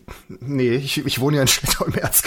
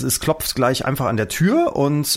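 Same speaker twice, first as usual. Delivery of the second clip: ordinary talk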